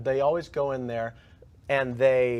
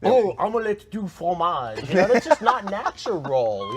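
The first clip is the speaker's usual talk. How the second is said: slow, loud, higher hammed-up French accent; mock French accent; 6 semitones higher, 5 dB louder, slower than usual. Cohost asked to sound French, slow hammed-up French accent. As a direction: ham up a fake French accent on request. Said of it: "I wanna hear you try to sound French".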